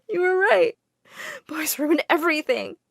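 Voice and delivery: high-pitched, crying voice; tearful. A narrator's reading. Girl confiding in the widow after heartbreak, in tears. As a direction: admit it through sobs. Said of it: "I burst into tears and told her everything".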